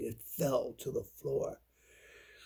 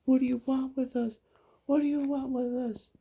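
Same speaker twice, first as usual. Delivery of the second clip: high, louder voice of frightened crying brothers; tearful cry; 13 semitones higher, 5 dB louder than usual. A narrator's reading. Storyteller as brothers facing a ghostly girl, crying. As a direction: plead in a high-pitched, acted cry. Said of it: "while they were crying".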